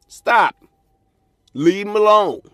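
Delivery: loud, high, insistent command; loud warning. Real, not acted. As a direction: shout it at them so they finally listen. Pleading with women to listen.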